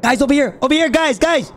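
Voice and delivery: high, loud shout to teammates; calling out. Real, not acted. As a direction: yell so they find you. Calling friends across the map.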